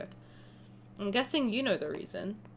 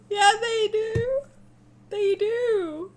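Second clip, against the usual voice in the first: tearful; 9 semitones higher, 5 dB louder, much slower than usual. Real, not acted. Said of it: "Oh, I'm sure I'm tearing up already".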